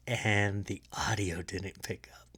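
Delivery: hushed secret whisper, slow. Acted. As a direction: lean in and whisper it. Telling an inside secret.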